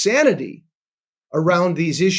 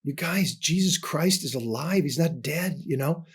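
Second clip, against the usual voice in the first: softer, with a different tone from his own; earnest hushed voicing; 6 dB quieter than usual. Sister telling the family at the table, softly earnest. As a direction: lightly voice the sister's earnest news. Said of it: "she goes".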